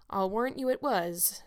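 ordinary delivery, nothing marked

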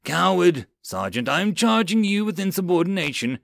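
screaming accusation. Acted. Lieutenant charging sergeant with insubordination, screaming. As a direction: shout it as an angry accusation. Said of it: "continued to scream at Mills".

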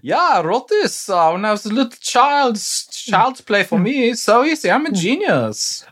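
put-on high, smug child prodigy voice; boastful Mozart voice. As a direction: brag like a spoiled genius.

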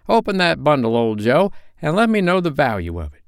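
put-on cheeky old woman daring the others; bold charwoman. A narrator's reading. Charwoman voiced.

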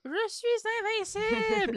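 mock shout. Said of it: "qui va crier".

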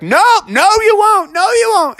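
high, firm mom voice; emphatic falsetto veto; refusing mother. Acted. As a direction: shut it down in a high protest. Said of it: "Mother Frog was like".

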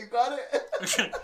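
"You got it?" drawn out slowly, loud and high; slow teasing repeat. Shown as a demonstration. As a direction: stretch out "You got it?" playfully. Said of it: "repeated drawn out".

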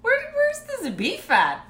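put-on high, slow puzzled old lady voice; confused grandma. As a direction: ask it like a baffled grandma hunting for beef. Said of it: "She goes".

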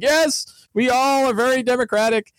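high, droning chorus of followers; mock cultists agreeing as one; obedient cult followers. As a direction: chant it high like brainwashed villagers. Cult followers chanting agreement in unison.

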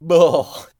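loud shuddering groan; loud ugh of disgust. Real, not acted. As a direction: blurt a revolted groan. Disgust after naming what made her shudder.

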